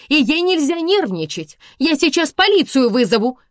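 high, loud warning; threatening. A narrator's reading. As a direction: threaten whoever is at the door. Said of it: "пригрозила она".

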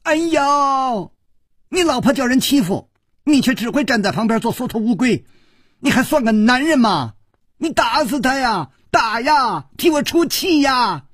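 high-pitched, indignant wailing complaint; foot-stamping tirade. A narrator's reading. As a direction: goad him furiously in a high voice. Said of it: "跺着脚说".

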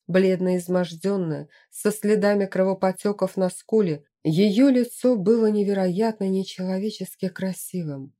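usual speaking voice

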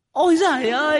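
very high shriek of fright; ghost shrieks. A narrator's reading. Female ghost is struck by the talisman, in a high shriek. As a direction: let out a piercing ghostly wail. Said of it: "Con ma nữ rú lên".

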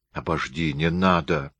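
ordinary delivery, nothing marked

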